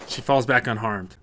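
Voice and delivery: plain reading voice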